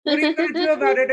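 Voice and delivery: fast, loud playful taunt; mock tough challenge. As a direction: dare him to fight, fast and loud.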